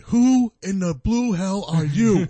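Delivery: high, slow, loud wrestler bellow; Rock impression. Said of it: "You're like".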